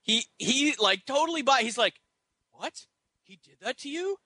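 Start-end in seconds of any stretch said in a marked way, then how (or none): 2.5-4.2 hushed, higher-pitched disbelief; mock concerned instructor. Lead instructor believing Scotty's story, hushed mock outrage.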